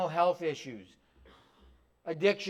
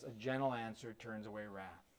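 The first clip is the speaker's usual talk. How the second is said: "gentle" stressed in a much softer, lower voice; 8 semitones lower, 15 dB quieter than usual. Shown as a demonstration. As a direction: hush down and lean into "gentle". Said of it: "as the proverb tells us".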